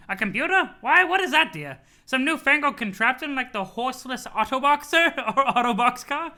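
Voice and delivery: put-on puzzled old granny voice; befuddled grandma, playing dumb; Nana voice. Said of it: "You love my Nana voice?".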